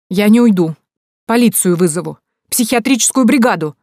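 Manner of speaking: loud threats climbing in pitch; increasingly heated. A narrator's reading. Mother threatening to call police, increasingly heated. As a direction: work yourself up with each threat. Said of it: "распалялась мать".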